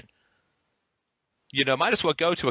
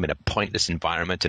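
ordinary talk

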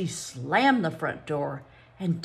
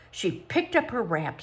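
ordinary delivery, nothing marked

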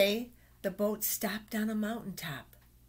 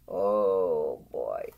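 put-on deep oh boy; 13 semitones lower, 5 dB louder than usual. Reader marvels at animals.

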